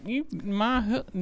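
high, slow, thick Southern drawl; hammed-up Southern accent; bad Southern accent. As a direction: imitate a shoddy Southern accent, drawn out. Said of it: "Shitty Southern accent".

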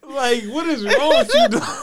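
high, loud and drawn-out what is wrong with you; hammed-up outrage at a friend; mock scolding.

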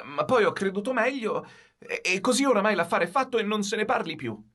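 high, halting evasions building; hesitant stammering. A narrator's reading. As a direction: fumble for excuses, then cut it short. Boy stammering evasive excuses. (ragazzo imbarazzato che balbetta scuse alla madre)

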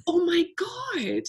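laughing at her own silliness; giggling in disbelief. Real, not acted. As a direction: squeal then slow amused sigh. Laughing at herself.

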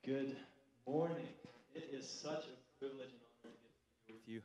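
ordinary delivery

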